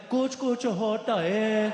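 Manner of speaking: slow, loud filmy Hindi delivery; Hindi line. Acted. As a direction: deliver it like a Bollywood hero. [name] answers in Hindi film line.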